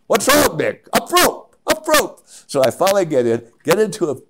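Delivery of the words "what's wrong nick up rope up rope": put-on very high, loud Swiss-accented holler; mock Swiss yell from below; shouted Swiss accent. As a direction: imitate an insistent Swiss climber calling up. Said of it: "he has his Swiss accent".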